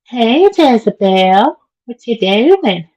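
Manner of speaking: high, slow sing-song greeting; sing-song call. Real, not acted. Calling to someone off-mic.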